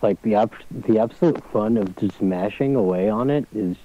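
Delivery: muffled voice lying on a bed. Real, not acted. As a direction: sounds far off and muffled. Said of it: "are you at the bottom of the swimming pool?".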